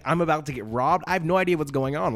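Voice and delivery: plain reading voice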